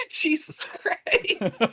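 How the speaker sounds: high, drawn-out, breathless laughing words; laughing hard. Real, not acted. Player cracking up at the slapping image, laughing hard. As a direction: squeeze it out through high-pitched laughter.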